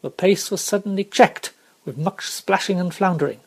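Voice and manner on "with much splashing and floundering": ordinary delivery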